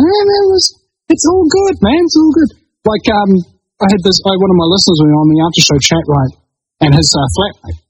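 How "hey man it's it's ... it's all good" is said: mock chill counselor. Reassuring the distraught in high mellow voice. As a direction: soothe them like a stoner guru.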